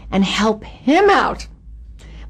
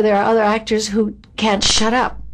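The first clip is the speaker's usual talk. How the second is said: ordinary talk, nothing marked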